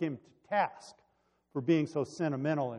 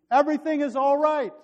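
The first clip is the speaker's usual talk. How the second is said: loud, high-pitched call; exclaimed announcement, pitched way up; crying out; 13 semitones higher, 9 dB louder than usual. A narrator's reading. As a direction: call out the all-clear at full voice. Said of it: "cried out to the king".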